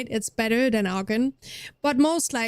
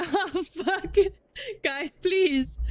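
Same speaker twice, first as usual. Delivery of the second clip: laughing curse; 7 semitones higher, much slower than usual. Real, not acted. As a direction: laugh it out, half a swear. Laughing at chat comment.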